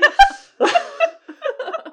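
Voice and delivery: big high-pitched burst of laughter. Real, not acted. Teller laughing at his own memory, high. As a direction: crack up at the absurdity.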